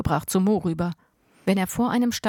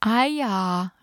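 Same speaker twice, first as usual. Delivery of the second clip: loud call; 3 semitones higher than usual. A narrator's reading. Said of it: "rief Mo so laut".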